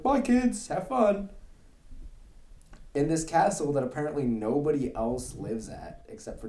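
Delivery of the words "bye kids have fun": high, carefree goodbye; breezy parent voice. Retold or imitated. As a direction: wave them off without a care. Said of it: "they're just like".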